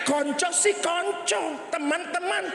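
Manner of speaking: lightly high, slow; a touch of high voice. Acted. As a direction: say it in a mock high voice. (suara agak tinggi)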